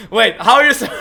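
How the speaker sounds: loud, laughing question. Real, not acted. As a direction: break into laughter mid-question. Laughing at teasing about their accent.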